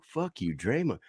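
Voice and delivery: put-on young sons repeating drunk fans, small and high; kids copying. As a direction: parrot the swear innocently like a little kid. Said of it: "my seven and eleven-year-old are like".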